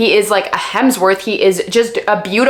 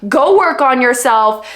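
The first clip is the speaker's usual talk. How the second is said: exasperated advice; 6 semitones higher than usual. Real, not acted. Telling a character off.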